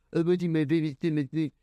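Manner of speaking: a hint of a trendy skit creator; mock skit voice.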